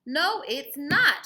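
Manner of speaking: put-on slow, loud, high child shout. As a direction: shout it back slowly. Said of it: "shouted Ruthie".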